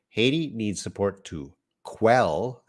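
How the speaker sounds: ordinary delivery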